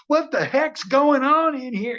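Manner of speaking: high, loud, drawn-out hammy outburst; over-the-top movie guy. Acted. Actor playing seventies horror guy, over the top. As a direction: play the big seventies horror-movie character, hamming it up. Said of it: "I wanted to be the guy who's like".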